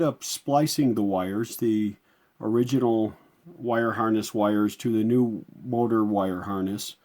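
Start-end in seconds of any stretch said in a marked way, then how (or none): none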